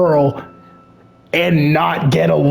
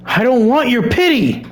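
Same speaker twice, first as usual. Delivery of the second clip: sudden hurt outburst, higher; 7 semitones higher than usual. Acted. Yuri lashing out at Sayori, a mock yell. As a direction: snap it out in hurt. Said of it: "Sorry, I'm just screaming".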